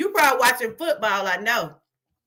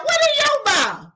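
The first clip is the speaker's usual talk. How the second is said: much higher mocking echo; 12 semitones higher, 4 dB louder than usual. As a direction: squeak it back mockingly. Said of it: "Talking about".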